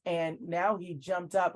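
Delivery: ordinary talk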